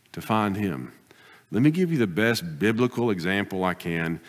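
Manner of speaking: normal talking voice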